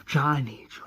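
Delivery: hushed begging; pleading whisper. Retold or imitated. Lori pleading with Shane.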